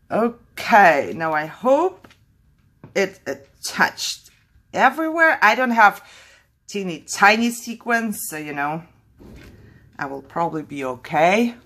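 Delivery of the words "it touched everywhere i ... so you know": ordinary delivery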